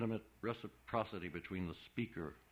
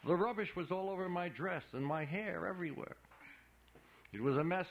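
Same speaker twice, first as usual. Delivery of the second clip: ordinary delivery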